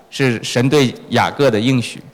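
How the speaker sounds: usual speaking voice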